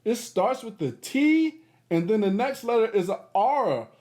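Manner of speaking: ordinary delivery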